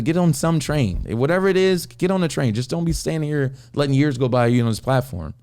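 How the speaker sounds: high-pitched, insistent pep talk; retold fatherly urging. Father urging son to get moving, insistent.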